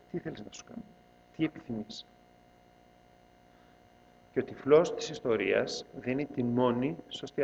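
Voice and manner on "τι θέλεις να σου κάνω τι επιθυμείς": hushed question. A narrator's reading.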